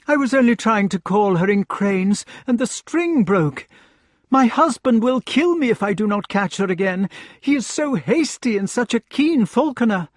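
high, loud fretful lady's voice; lady's pleading voice; anxious lady. Acted. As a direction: plead like a frightened noblewoman. Lady voiced in read story.

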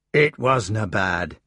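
slow, gruff Scottish understatement; a Scots knight shrugging it off; Scottish accent. Acted. Gawain answering his brother, slow Scottish accent. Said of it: "He still kept his outland accent".

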